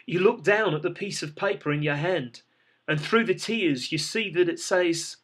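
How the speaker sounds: plain reading voice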